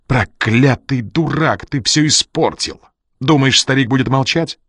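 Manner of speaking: high, tight, furious scolding; strangled fury. A narrator's reading. Жанна berates Ян for spoiling everything, furious. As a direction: berate him through a tight throat. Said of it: "голос её прозвучал одновременно сдавленно и яростно".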